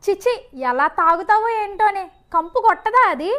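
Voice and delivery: loud, nagging tirade down the phone; angry scolding mother. Acted. Mother scolding her student daughter over the phone, loud and angry. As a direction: yell at her like a fed-up mom.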